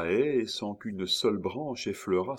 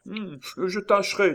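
higher, louder, effortful reply; straining effort; 11 semitones higher, 6 dB louder than usual. A narrator's reading. Miton trying to get over the hedge, straining. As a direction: push the words out with effort. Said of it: "il fit de nouveaux efforts".

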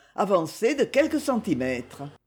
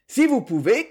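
higher, mildly raised call; 5 semitones higher, 4 dB louder than usual. A narrator's reading. Rick advises Lucette on the cliff, calling out. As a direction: lift pitch to call across. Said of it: "cria Rick".

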